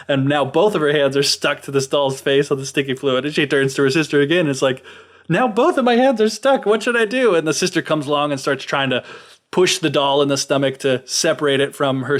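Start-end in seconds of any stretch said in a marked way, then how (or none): 5.3-7.3 high-pitched, hammed-up helpless complaint; mock panic. Fairy with both hands stuck begs her sister, in high mock panic. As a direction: play a fairy getting more flustered, voice pitched way up.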